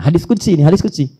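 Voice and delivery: ordinary talk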